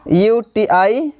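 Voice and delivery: ordinary talk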